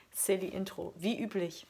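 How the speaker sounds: ordinary talk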